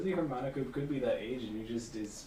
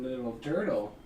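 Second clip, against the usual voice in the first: usual speaking voice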